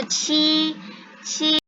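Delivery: Ci held long on a level first tone. Shown as a demonstration. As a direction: sustain each Ci evenly, like a Mandarin teacher. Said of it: "Q I nada satu". (Ci panjang dengan nada satu yang datar)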